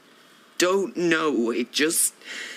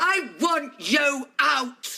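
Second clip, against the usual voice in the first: high, slow, emphatic shout; furious order; 6 semitones higher, slower than usual. Acted. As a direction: throw her out, hammering each word. Mother throws daughter out.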